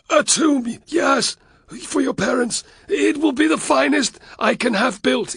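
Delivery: high, loud frantic promise; groveling Varus. A narrator's reading. Varus voiced, pleading.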